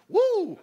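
high, loud whoop. Real, not acted. Preacher whooping at his own joke about his wife. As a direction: let out a big whoop.

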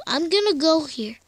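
ordinary talk, nothing marked

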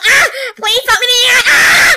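high, quick cry for help; hammed-up panic rising into a yell; panicked plea. Begging for help in a skit, panicked.

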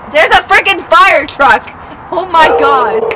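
loud outburst; exasperated exclamation. Real, not acted. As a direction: cry out loudly at the interruption. Exclaims at a passing fire truck.

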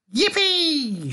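very high, drawn-out whoop; gleeful shout. A narrator's reading. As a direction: whoop with joy. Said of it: "shouted Grandpa Joe".

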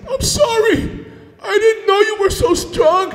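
high, whimpering mock begging; grovelling plea. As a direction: beg for mercy in a high voice. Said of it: "he got down on his knees".

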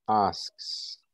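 ordinary talk, nothing marked

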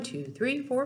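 usual speaking voice

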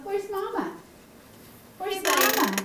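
high sing-song question. Real, not acted. Grandma asking the baby, high sing-song. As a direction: ask it up high like a game.

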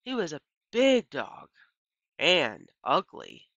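plain reading voice

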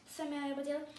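usual speaking voice

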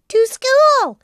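put-on high, loud puppet-boy fib; lying little boy. A narrator's reading. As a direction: blurt the lie brightly like a little boy. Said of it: "lied Pinocchio".

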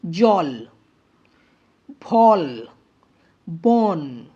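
জল, ফল, বন, each one drawn out with emphasis; long syllable. Shown as a demonstration. Teacher showing long-syllable words জল, ফল, বন, drawn out. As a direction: stretch the vowel in জল, ফল, বন, pausing between words. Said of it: "উচ্চারণটা আমাদের এখানে অনেকটা দীর্ঘ হচ্ছে".

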